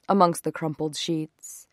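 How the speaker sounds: plain reading voice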